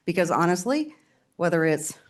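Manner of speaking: normal talking voice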